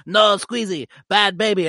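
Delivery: hammed-up little alien scolding a baby; high alien voice. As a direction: scold like a tiny creature. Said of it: "He goes".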